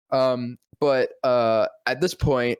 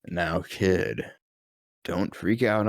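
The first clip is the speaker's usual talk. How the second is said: much lower and softer, deadpan Batman; low murmur like Batman; 9 semitones lower, 5 dB quieter, slower than usual. Retold or imitated. Batman introducing Mark Hamill, low deadpan voice.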